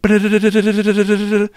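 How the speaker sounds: high, rapid trilled electronic warble; imitated device alarm. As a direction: trill like a gadget going off, high and loud. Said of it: "rem pod starts singing".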